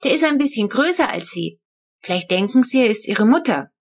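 put-on high child's voice, earnest; a small girl explaining kindly; little Flo. A narrator's reading. Child voice continues.

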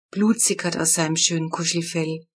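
usual speaking voice

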